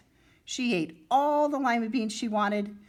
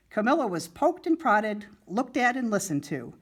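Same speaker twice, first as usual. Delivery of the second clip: ordinary delivery, nothing marked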